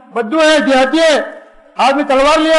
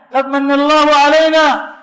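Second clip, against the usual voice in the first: recitation; 3 semitones higher, slower than usual. Acted. Quranic phrase recited.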